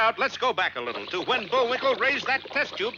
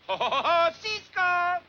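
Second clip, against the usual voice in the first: cowboy show parody; 7 semitones higher than usual. Acted. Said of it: "The narrator says".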